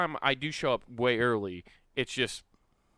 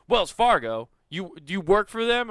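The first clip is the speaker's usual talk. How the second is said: higher, louder surprised outburst; surprised question; 7 semitones higher, 6 dB louder than usual. Retold or imitated. Airport worker shocked by the Wells Fargo stamp, surprised and loud. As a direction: pop up in pitch with disbelief.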